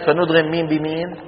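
ordinary delivery

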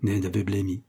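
ordinary delivery

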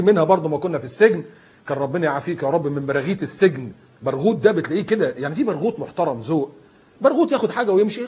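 ordinary talk, nothing marked

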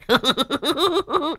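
very high, rapid giggle; soft high-pitched tittering laugh. Acted. Mocking laugh after an insult. (cười khúc khích the thé)

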